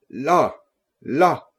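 two halting, separated words; mild shocked stammer. A narrator's reading. Narrator points out the dead man's hands, lightly stammering. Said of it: "en bégayant".

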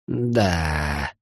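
put-on low, soft creak of an old man; creaky. A narrator's reading. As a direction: creak it out quietly and reluctantly. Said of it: "проскрипел Эдуардыч". (тихо, скрипучим голосом)

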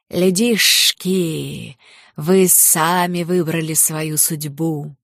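slow, drawn-out monster voice; serpent demon. Acted. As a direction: stretch each word like a looming snake. Giant serpent demon speaks. (медленный голос змея-демона)

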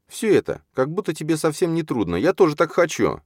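high, envious complaint; pitched well up, wanting it too. A narrator's reading. Чарна complains to Юрген that she wants it too, envious. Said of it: "спросила завистью".

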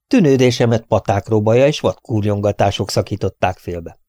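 usual speaking voice

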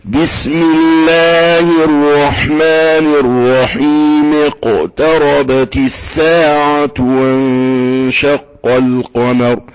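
slow tajweed chant with the verses joined; flowing Quran recitation; connected recitation. Shown as a demonstration. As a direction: recite slowly, linking each verse to the next. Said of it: "لكن لو وصلتها هتسقط هكذا".

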